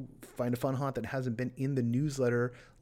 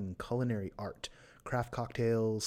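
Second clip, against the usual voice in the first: ordinary talk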